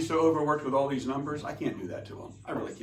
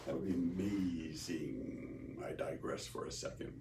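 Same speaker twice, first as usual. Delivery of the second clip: whisper; 9 semitones lower, 14 dB quieter than usual. Real, not acted. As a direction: breathe it as a hushed aside. Aside to himself.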